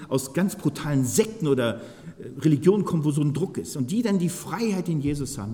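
ordinary talk

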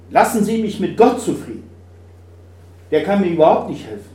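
hoch und laut, abweisend; stoß ihn verzweifelt weg; verzweifelter Mann. Acted. Verzweifelter Mann an der Klippe. (der Mann an der Klippe wehrt den Christen laut ab)

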